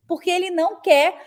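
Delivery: usual speaking voice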